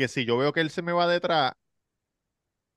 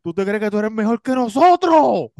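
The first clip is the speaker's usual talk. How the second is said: higher, louder mocking taunt; menacing stranger; 6 semitones higher, 6 dB louder than usual. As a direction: taunt him like a crazed stranger. Retelling the man's taunts.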